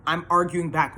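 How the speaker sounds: ordinary talk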